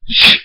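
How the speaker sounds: loud hissed whoosh of a blade; stabbing sound effect. Retold or imitated. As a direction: voice the knife strike. Stab sound effect in fight.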